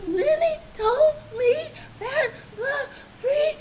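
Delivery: put-on high, crying child's voice; upset sobbing. Little sister tattles to mama, sobbing high. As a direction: blurt it out through sobs. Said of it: "Kimmy was so upset she couldn't get it out".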